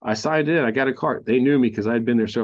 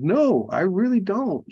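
higher, slow, mock stubborn denial; stubborn refusal; 9 semitones higher, slower than usual. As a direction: drag out the refusal like a sulky kid. Stubborn reply in retold argument.

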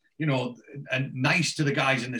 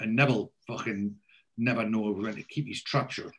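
ordinary talk, nothing marked

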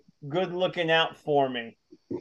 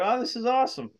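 retold delight; 8 semitones higher, faster than usual. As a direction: relive the pleased discovery in a higher voice. Retells delight at finding a parcel.

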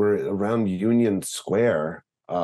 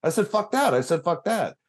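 high protest; 10 semitones higher than usual. Retold or imitated. Retold self pleading with angry guy.

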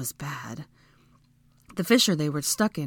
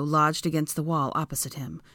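ordinary delivery

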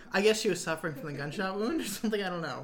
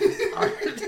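laughing. Real, not acted. Laughing at the synopsis.

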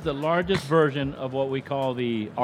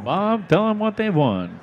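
game show host; 5 semitones higher, 3 dB louder, slower than usual. Pretend. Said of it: "Price is Right".

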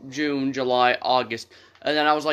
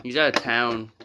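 ordinary talk

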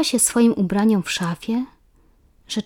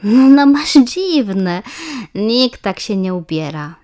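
teasing schoolgirl; 2 semitones higher, 7 dB louder, slower than usual. A narrator's reading. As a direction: needle her friend, insisting. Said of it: "nie daje za wygraną Iska".

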